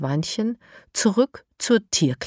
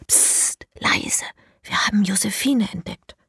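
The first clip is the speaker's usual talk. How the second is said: unvoiced hushing whisper; 3 semitones higher than usual. Elias hushes the other children at the pipe, whispering. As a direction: hush the others urgently. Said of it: "sagt Elias".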